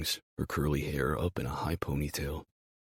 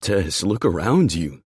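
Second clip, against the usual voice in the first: loud, slow, insistent; loud exasperated demand; voice rising; 3 semitones higher, 9 dB louder than usual. Acted. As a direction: make her face it, voice raised. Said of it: "my voice rising".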